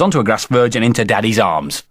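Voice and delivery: plain reading voice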